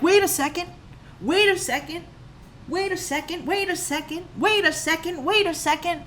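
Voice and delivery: high-pitched, insistent halting repetition; mock alarm. Viewer interrupting the film in confusion, mock alarm. Retold alarmed reaction, repeated.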